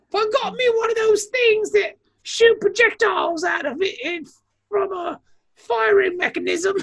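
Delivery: high put-on British voice; musing English cop; mock British. Mock Luther wanting pistol.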